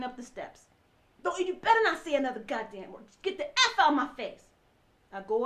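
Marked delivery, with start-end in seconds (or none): 1.2-4.4 louder, raised threatening shout; angry yell. Retold or imitated. Husband yelling at his wife as she hurries upstairs, louder and angry. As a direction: drive her out of the room with it. Husband screaming at her on stairs.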